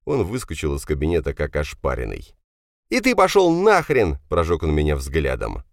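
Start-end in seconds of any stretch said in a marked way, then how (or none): 2.9-4.2 loud, high-pitched parting shot; furious curse. Acted. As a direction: hurl it angrily at the bystander. Furious son curses narrator. (злой высокий выкрик)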